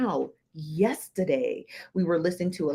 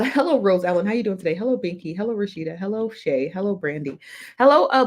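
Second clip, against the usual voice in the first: ordinary talk, nothing marked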